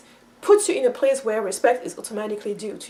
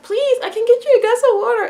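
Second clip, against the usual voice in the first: high, louder begging to be allowed to help; eager pleading child; 11 semitones higher, 5 dB louder than usual. Retold or imitated. Little sister begging to bring someone water, eagerly pleading. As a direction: mimic a little girl pleading to serve. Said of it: "it was pleasing to her".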